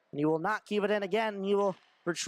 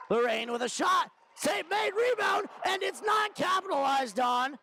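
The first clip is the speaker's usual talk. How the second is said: higher, urgent play-by-play shout; excited shot call; 9 semitones higher than usual. Real, not acted. Hockey announcer calling a missed chance on goal, excitedly. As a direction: voice leaps up high on the save and rebound.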